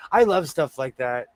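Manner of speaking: normal talking voice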